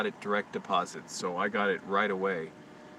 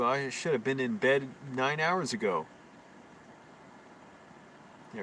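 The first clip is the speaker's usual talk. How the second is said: ordinary talk, nothing marked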